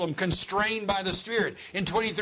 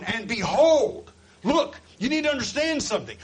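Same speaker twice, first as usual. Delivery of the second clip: raised, urgent preacher's command; 7 semitones higher, 5 dB louder than usual. Acted. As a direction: seize their attention, high and loud. Acting Jesus commanding the apostles.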